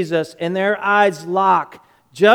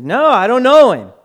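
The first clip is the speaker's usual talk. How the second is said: louder, higher, insistent denial; firm denial; 4 semitones higher, 7 dB louder than usual. Retold or imitated. Peter denying Jesus to another girl, firm raised-voice denial. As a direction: deny it firmly, voice raised.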